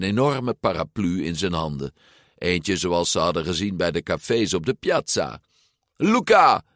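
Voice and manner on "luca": high, loud hail; calling out. A narrator's reading. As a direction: call out to a friend. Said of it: "riep hij". (luid roepend)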